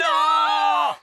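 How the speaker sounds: shouted no. Acted. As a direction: shout it down at once. Elf refuses the song.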